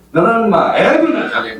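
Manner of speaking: 경상도 사투리로 쏘아붙이듯; Gyeongsang dialect retort. Retold or imitated. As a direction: snap back in broad Gyeongsang dialect. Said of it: "발음 좀 똑바로 하세요".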